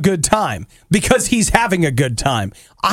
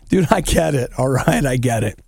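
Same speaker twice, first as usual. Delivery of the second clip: ordinary delivery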